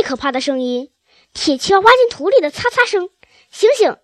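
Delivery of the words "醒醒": high, loud alarmed cry; shouting to wake. Acted. Mr Fox wakes his sleeping family, loud high alarmed shout. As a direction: jolt them awake with a loud shout. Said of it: "他喊着".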